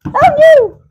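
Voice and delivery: sudden high-pitched squeal of fright; squealy scream. Real, not acted. Startled squeal while stacking apples. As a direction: shriek in real surprise. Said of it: "I squealy screamed".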